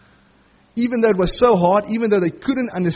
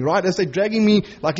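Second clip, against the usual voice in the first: ordinary talk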